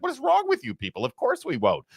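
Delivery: high, scoffing incredulity; like the question is insane; imitating the president's disbelief; incredulous. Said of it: "the incredulity that he displays".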